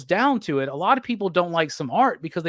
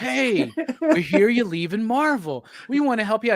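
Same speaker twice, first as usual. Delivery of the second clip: mock eager executives; 5 semitones higher than usual. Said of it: "they're all like".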